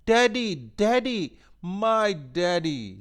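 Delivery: high, loud, slow cry of joy; a girl screaming for her father on seeing him; joyful scream. A narrator's reading. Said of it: "she screamed".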